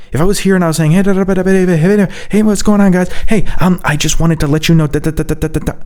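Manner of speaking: high-pitched, agitated babbling demo; anxious, panicked chatter. Shown as a demonstration. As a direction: rattle it out in a hammed-up panic. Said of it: "it's gonna make you so anxious".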